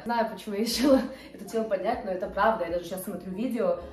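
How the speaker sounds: ordinary talk, nothing marked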